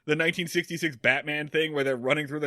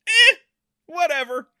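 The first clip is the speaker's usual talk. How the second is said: mock indifference, pitched up; breezy, couldn't-care-less brush-off; dismissive shrug; 12 semitones higher, 9 dB louder than usual. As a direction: toss it off with a higher-voiced verbal shrug. Acts out show's shrug.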